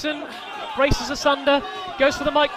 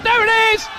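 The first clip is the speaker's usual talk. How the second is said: high excited shout; 10 semitones higher, 7 dB louder than usual. Real, not acted. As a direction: shout it as the finisher hits. Finisher lands.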